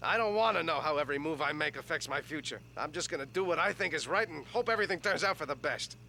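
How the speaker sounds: low, measured heartfelt speech; earnest reflection. Acted. Man tells his other selves about facing the future, earnest reflection.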